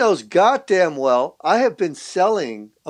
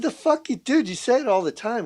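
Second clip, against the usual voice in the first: incredulous retort; 7 semitones higher than usual. Retold or imitated. Retorting to the head guy.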